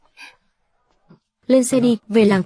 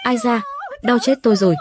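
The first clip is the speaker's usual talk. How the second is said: pained cry; 3 semitones higher than usual. Acted. Grandmother hit in the scuffle.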